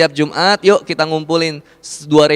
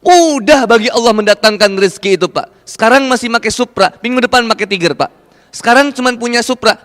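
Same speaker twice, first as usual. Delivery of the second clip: raised, ringing sermon voice; fervent preaching; 7 semitones higher than usual. Acted. Preacher urging the congregation to trust God's provision, fervent. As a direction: preach it high and full to the crowd.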